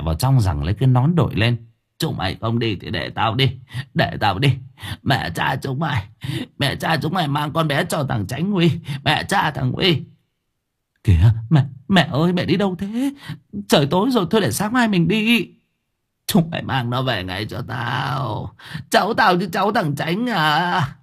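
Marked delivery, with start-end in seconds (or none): 2.0-10.0 put-on hissing old hag grumbling; muttered curses. Grandmother grumbling curses as she storms off. 11.0-15.5 higher, pleading daughter-in-law; anxious plea. Acted. Daughter-in-law begging grandmother not to leave at night. 16.3-21.0 slow, hissing crone threat; slow menacing hag. Acted. Grandmother cursing as she storms out, slow and menacing. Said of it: "vừa chửi".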